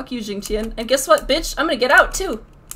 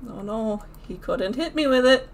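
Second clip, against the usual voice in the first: ordinary talk, nothing marked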